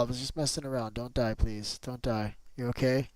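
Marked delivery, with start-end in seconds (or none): none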